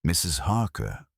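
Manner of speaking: ordinary delivery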